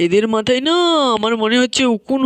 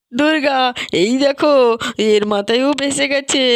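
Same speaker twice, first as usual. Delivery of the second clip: ordinary talk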